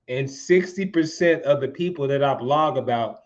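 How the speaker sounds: ordinary talk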